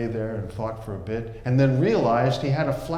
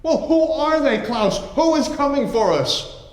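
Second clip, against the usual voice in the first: much higher, louder panicked questions; frantic Ulrich; 13 semitones higher, 7 dB louder than usual. Acted. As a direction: beg for the answer in panic.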